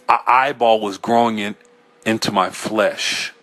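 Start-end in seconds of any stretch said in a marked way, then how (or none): none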